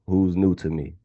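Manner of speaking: ordinary talk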